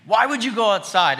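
put-on a boy scolding his dad in the driveway; child's reprimand. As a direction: scold Dad like a frightened seven-year-old. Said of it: "And I reprimanded him".

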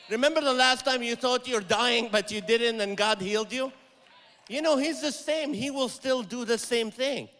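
loud, rising preacher's call to the congregation; preaching hard. Acted. Preaching at full force.

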